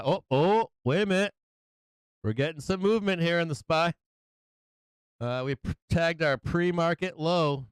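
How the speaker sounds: sudden thrill at the move; excited. Real, not acted. Chart moves, excited reaction.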